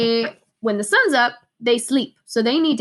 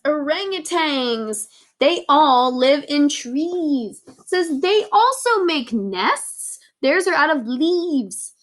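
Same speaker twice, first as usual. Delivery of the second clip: ordinary delivery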